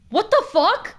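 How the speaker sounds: real startled shout; shocked outburst. Real, not acted. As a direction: jolt at a sudden scare. Startled by sudden noise.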